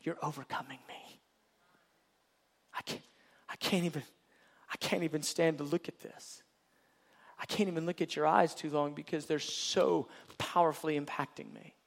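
hushed, faltering, fading to a whisper; overwhelmed. Acted. The Lord to his people, hushed and overwhelmed. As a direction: breathe it out, undone by the gaze. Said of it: "what the Lord's saying?".

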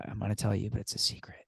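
secretive whisper. Retold or imitated. Imitating Danny whispering a secret name, whisper. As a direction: hush it like a secret. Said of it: "whispers in my ear".

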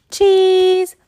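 high, loud photo cheer; cheese shout. Retold or imitated. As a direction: shout it for the camera. Posing for a photo.

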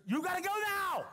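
high, urgent mock scream; retold scream. Son's wife on the phone screaming at grandpa to hurry. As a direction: push her panic onto the last words. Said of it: "She's screaming".

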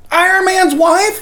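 high, loud, drawn-out disbelief; mock shock. Reacting to cohost's tragic retirement news, mock shock. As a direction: cry it out in hammed-up shock.

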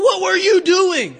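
high, loud yell of a name and question; boss yelling. Retold or imitated. Boss yelling at teenage stock boy, loud and high. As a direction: shout it like an angry grocery boss.

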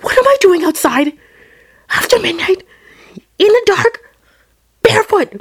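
very high, loud, alarmed questions; retold panic. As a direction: squeak it out in mock panic, phrase by phrase. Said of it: "I just panicked".

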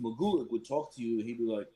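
ordinary delivery

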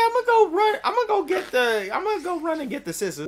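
put-on high chirpy kid; little girl voice. Girl deciding to fetch scissors, high kid voice. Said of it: "her instant thought".

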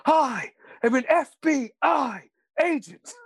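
high, slow hammed-up bro; Keanu Reeves. Fan redoing Point Break for co-host, high drawn-out impression. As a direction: stretch each word in a mock surfer-agent voice. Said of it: "him in, like, Point Break when he's like".

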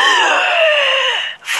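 hohes, lautes Aufkeuchen; jäh nach Luft schnappen; erschrockenes Luftschnappen. Acted. Voldemort erwacht. (schrilles Keuchen)

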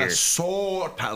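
imitating the professor's exaggerated Minnesota; a hammed-up Minnesota accent; mock Minnesota accent. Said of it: "would dip the mouth on the O".

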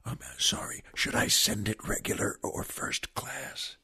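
hushed, breathless library whisper; whispering a mock question to the librarian; leaned-in whisper. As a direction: lean in close and whisper it so no one hears. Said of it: "so I leaned in and said".

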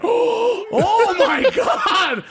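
high, loud, slow gasped oh my God; dragged out in dawning horror; caught-out shock; mock shocked. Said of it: "I was like, gasps".

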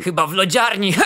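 a prankster uncle firing off a cheeky jab; joking uncle quip. Acted. Uncle teasing the nephew at the table, loud cheeky quip. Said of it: "nie wytrzymał i wypalił".

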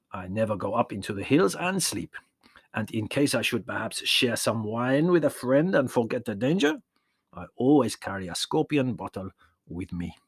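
put-on warm old peasant shepherd, proud of his trick; old Yanni voice. A narrator's reading. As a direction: voice the old shepherd showing the boy. Yanni draws the moral.

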